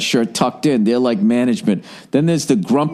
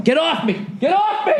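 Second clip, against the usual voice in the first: much higher, rising mock yell of a man being grabbed; retold panicked yell; 14 semitones higher than usual. Man grabbed by store staff yelling to be let go, high mock panic. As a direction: climb higher on the second cry, playing the panicked man. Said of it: "I just hear this guy going".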